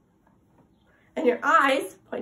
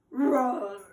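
mock roar; 4 dB louder than usual. Shown as a demonstration. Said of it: "we can go".

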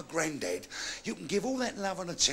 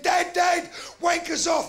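put-on high, loud kid raising the alarm; alarmed shout; 10 semitones higher, 6 dB louder, slower than usual. Boy bursting into dad's bedroom, shouting alarm. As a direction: yell for dad urgently.

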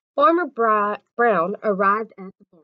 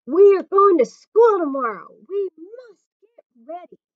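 higher and much louder, eager; excited announcement; 9 semitones higher, 9 dB louder than usual. Acted. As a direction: call out the big news.

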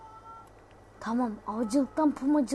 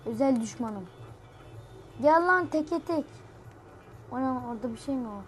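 usual speaking voice

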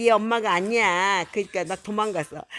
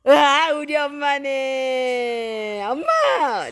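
slow, drawn-out delighted cry, rising higher; joyful reunion; 4 semitones higher, much slower than usual. Acted. Baby animal in the story finally finding its mother, drawn-out joy. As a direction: stretch it out with joy at finding mom.

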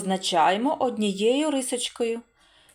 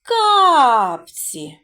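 Капці louder, with кап hit hard; stress on the first syllable; 7 dB louder than usual. Shown as a demonstration. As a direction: land firmly on кап in Капці. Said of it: "Наголос на який склад падає?".

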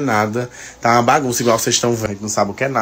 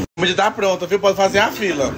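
raised sing-song call to the table; 8 semitones higher than usual. Real, not acted. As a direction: call everyone to come eat. Mother calls kids to eat. (chamando as crianças para comer)

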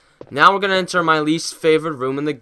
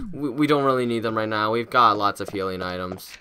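ordinary talk, nothing marked

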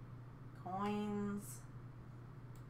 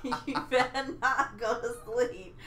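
laughing threat; 3 dB louder than usual. Real, not acted. Teasing threat through laughter.

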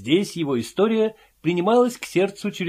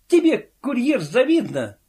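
snapping back; 8 semitones higher than usual. A narrator's reading. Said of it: "огрызнулся Филюрин".

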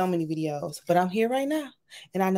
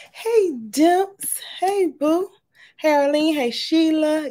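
excited; 8 semitones higher, 4 dB louder, slower than usual. Real, not acted. High excited greetings.

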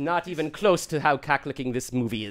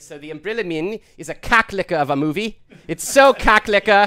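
South African accent; 2 semitones higher, 8 dB louder than usual. Shown as a demonstration. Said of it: "Can you say lekker?".

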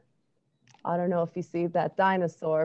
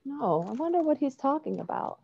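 plain reading voice

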